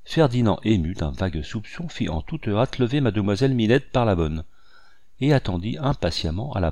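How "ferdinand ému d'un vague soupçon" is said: usual speaking voice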